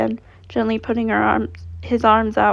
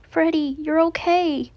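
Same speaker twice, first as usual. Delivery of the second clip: relieved joy; 6 semitones higher, slower than usual. Acted. Boy reunites with Freddy.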